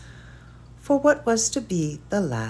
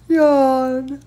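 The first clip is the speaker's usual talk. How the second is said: a big loud high yawn; yawning out the word; 8 semitones higher, 8 dB louder than usual. A narrator's reading. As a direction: stretch the word into a real yawn. Narrator performs the yawn.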